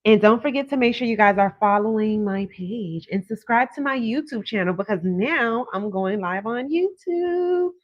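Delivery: ordinary talk, nothing marked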